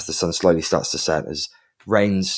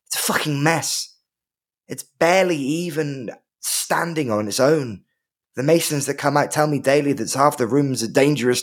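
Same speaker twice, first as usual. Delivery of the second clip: defiant noble lady defending home; fierce, proud landowner pleading her case; proud keep lady; 6 semitones higher than usual. Acted. In character as Orla, pleading.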